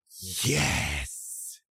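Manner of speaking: emphatic, breath-filled cry of elation; pure joy. Acted. Game master voices the rush of joy from the doll, elated. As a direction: let the word burst out in triumph. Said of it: "is joy".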